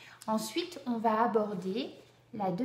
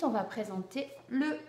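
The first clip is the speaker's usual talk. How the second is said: usual speaking voice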